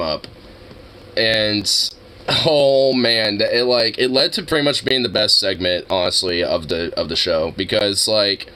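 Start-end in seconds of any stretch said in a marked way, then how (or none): none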